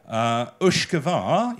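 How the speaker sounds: "Ushgavar" said slowly and carefully with a Gaelic sound; Gaelic pronunciation. Shown as a demonstration. Lecturer demonstrating the Gaelic word "Ushgavar" to the audience, slow and careful. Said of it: "that is Gaelic".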